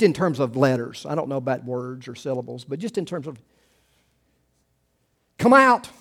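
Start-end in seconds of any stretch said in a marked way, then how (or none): 5.4-5.9 higher, louder ringing command; commanding call. Acted. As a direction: call it out like Jesus at the tomb. Jesus's command quoted.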